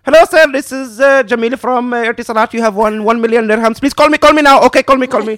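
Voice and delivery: high, loud mock scam caller; hammed-up foreign caller, pressing hard; urgent phone scammer. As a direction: rattle out an excited, pushy sales plea. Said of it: "urgently calling me".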